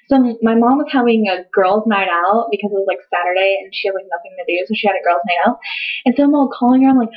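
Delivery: ordinary talk, nothing marked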